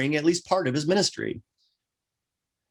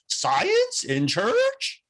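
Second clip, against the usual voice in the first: very high, slow, drawn-out disbelief on church; mocking the outraged critics, voice soaring in disbelief; incredulous; much slower than usual. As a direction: let the disbelief climb way up. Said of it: "the incredulity driving the emphasis on that word up and up".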